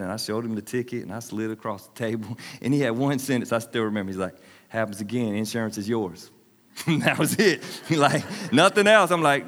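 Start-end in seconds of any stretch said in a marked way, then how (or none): 4.7-6.3 lightly voiced dad, lower and softer; understated stern dad. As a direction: quietly lay down the law like a father. Quotes his dad's one sentence.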